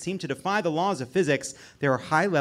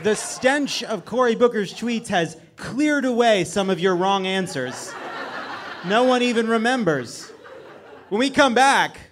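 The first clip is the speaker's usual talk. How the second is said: game show host; 5 semitones higher than usual. Acted.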